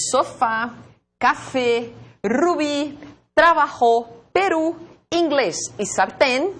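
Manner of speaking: each word from "sofá" to "sartén" punched on its final syllable; stress on last syllable. Shown as a demonstration. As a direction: list "sofá, café, rubi" separately, ending each on the stressed syllable. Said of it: "Porque a sílaba tônica é a última".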